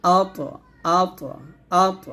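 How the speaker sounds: আত with a thick T that echoes after it; heavy bounced T. Shown as a demonstration. Teacher drilling pupils on qalqalah, T heavy and echoed. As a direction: bounce a full, rounded T off আত. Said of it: "মোটা করে".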